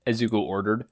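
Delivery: ordinary delivery, nothing marked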